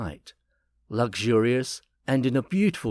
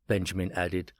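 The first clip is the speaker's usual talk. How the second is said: ordinary delivery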